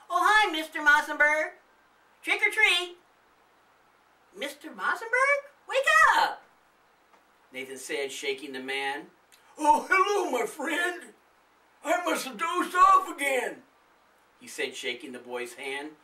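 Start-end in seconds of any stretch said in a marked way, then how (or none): 0.1-6.4 put-on high, loud child calling out; a small boy waking an old man; little boy voice. Nathan greeting and waking the old neighbour, high and loud. 9.6-13.6 put-on high, loud sleepy old man; an old man just woken, booming. The old man greeting the boy after dozing, high and loud.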